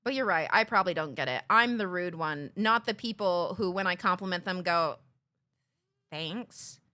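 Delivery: low, fast sarcastic concession; heavy mock apology. Real, not acted. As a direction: say it dripping with irony. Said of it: "heavily sarcastic".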